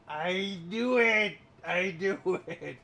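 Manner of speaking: slow, high, laughing "I knew it". Real, not acted. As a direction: laugh it out triumphantly. Gloating at guessing dad joke.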